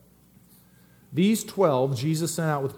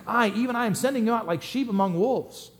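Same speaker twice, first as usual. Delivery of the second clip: "I, even I" raised high and weighted; stress on I; 6 semitones higher than usual. Shown as a demonstration. Pastor rereading the verse to stress Jesus himself, emphatic. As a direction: give "I" the weight each time. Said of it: "that's the emphasis".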